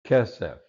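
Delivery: Kesef with a loud KE, falling after; accent the "Ke" of "Kesef"; "Kesef" punched on its first syllable; stress on first syllable. Shown as a demonstration. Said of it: "accent on the first syllable".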